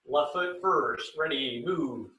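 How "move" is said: ordinary talk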